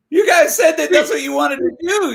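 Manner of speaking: high-pitched mock protest; indignant protest. Mock protest to friends backing out.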